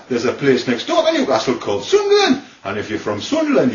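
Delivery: normal talking voice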